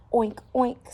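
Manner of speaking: high, loud oinks; piglet oinks. Acted. Piglet answering the neighbour, high oinks. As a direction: squeal twice like a piglet.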